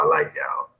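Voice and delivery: the same thanks whispered, with no voice; whispered repeat. Real, not acted. Host whispering his thanks back to chat. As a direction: breathe it out as a soft aside.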